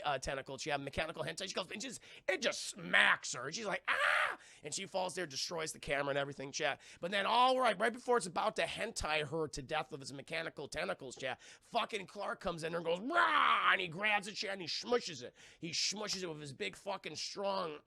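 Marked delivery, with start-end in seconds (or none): 3.9-4.4 very high mock shriek; imitated scream. Acts woman screaming when struck. 13.0-13.7 high, loud "rah"; imitated battle roar. Acts hero charging in.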